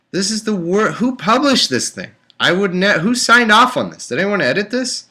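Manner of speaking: high, loud, indignant questions; outraged rant. Real, not acted. Reader raging at publishers, high indignant rant. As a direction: demand who let this through.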